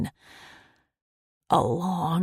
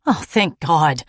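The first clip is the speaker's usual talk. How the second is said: loud, grateful outburst of relief; relieved exclaim; 10 dB louder than usual. A narrator's reading. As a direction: sigh it out with relief. Exhausted relief after long walk.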